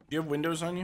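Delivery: ordinary talk, nothing marked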